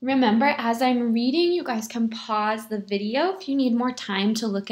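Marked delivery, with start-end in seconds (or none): none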